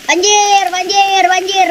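high, drawn-out repeated cries; playful alarm. Pretend. Player crying out as the flood rises, playful alarm. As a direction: call out the flood in high, stretched words.

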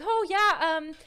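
high, loud fake-breezy agreement; hammed-up bluffing; put-on confidence. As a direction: overdo a casual oh yeah, then stall. Acting fake confidence as Sarah.

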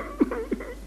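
high, soft mock sobbing; imitated crying. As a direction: put on a thin wail of grief.

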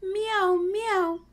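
high meowing; cat meow. Shown as a demonstration. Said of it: "Can you meow like a cat?".

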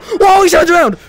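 high, loud yelp of surprise; alarmed shout. Real, not acted. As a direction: yell as it suddenly turns on you. Monster suddenly turns.

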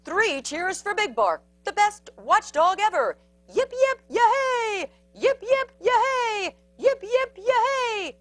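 high, loud hip-hip-hooray style cheering; high, rhythmic yipping celebration; three cheers. A narrator's reading. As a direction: lead a jubilant crowd cheer. Crowd gives three cheers.

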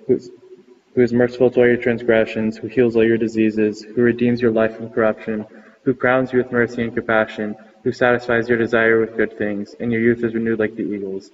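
normal talking voice

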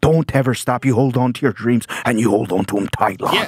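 comic imitating a drunk Irish fan cheering him on, Irish accent. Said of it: "an Irish traveler ... he goes".

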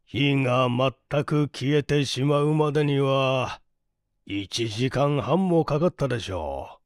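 ordinary delivery

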